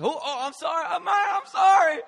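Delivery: high, loud mock-panicked shouting; hammed-up frantic apology after a terrible accident. Acts out accidentally stabbing friend.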